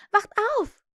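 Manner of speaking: high, loud wake-up call. A narrator's reading. Wendy shakes her brothers awake, calling out. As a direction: shout them awake, pitch leaping up. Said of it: "rief sie".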